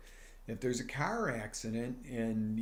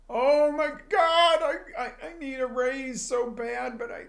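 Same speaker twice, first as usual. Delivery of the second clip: mock panic; 12 semitones higher, 7 dB louder than usual. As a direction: whine in fake dread about asking for a raise. Mock panicked worker wanting raise.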